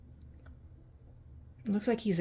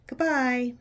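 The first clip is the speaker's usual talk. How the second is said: bright call, higher and louder; calling goodbye to a friend; kid saying goodbye; 6 semitones higher, 7 dB louder than usual. Retold or imitated. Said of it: "is saying".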